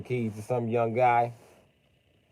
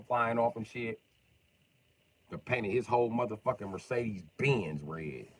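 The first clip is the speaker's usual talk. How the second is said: usual speaking voice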